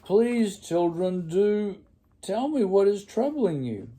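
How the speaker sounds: high, loud anxious plea; anxious pleading. A narrator's reading. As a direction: beg the children anxiously. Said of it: "And she said".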